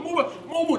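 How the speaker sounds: high, loud imitated command; urgent order. Ex-player acting a fired-up teammate barging in, urgent shouting. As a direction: clear everyone off the bench. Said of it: "He jumps up".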